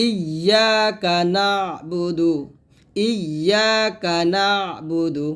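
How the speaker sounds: slow chant, throat-pressed close on "না"; drawn-out "না" ending in a throat catch; careful ayn. Shown as a demonstration. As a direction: recite "ইয়া কা না", squeezing the ayn before "বু দু". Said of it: "এটা করতে হবে সুন্দর করে".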